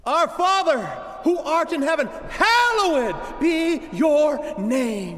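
high, loud, slow proclaimed Our Father; shouted prayer. Shown as a demonstration. Preacher shows the church how Jesus says to start prayer, shouting. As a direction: cry it out to heaven like a preacher at full force. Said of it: "start like this".

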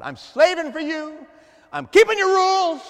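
loud, high, strained complaint; servile whine. Acted. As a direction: cry out like a worn-out servant.

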